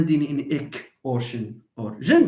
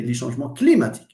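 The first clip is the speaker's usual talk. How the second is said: les changements climatiques said the French way, stress at the end; French pronunciation; 4 semitones lower, much faster than usual. Shown as a demonstration. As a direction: mimic a French-influenced speaker. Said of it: "Someone influenced by French".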